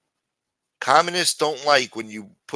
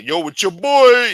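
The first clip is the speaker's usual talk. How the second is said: high, loud, slow mock bro voice; 12 semitones higher, 13 dB louder, slower than usual. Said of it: "talking about".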